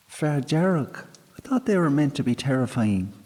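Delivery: put-on high, quick young girl; girl doubting the fairy's fierceness; cheeky girl. A narrator's reading. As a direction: tease him lightly. Girl teases sprite.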